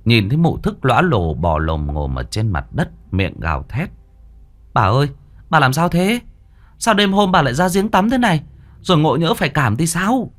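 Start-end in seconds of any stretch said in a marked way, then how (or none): 4.7-10.3 worried servant. Acted.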